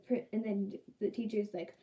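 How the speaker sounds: ordinary talk, nothing marked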